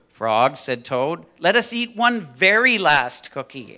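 loud, drawn-out storybook voice; milk the key words slowly for young listeners; big, swooping storytime delivery; animated children's story reading. A narrator's reading. Reads a children's story aloud.

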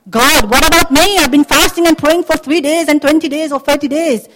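high, loud, aggrieved protest; rising anger. Acted. Believer complaining to God about being passed over, angry. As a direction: plead your case indignantly at God. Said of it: "anger rising up".

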